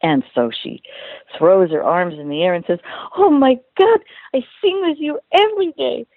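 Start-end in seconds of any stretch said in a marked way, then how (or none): 3.2-6.1 high, thrilled squeal of recognition; excited gush. Retold or imitated. Ride operator meeting the voice she hears daily, excited high gush. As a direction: gush like a starstruck fan, much higher.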